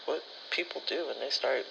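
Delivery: usual speaking voice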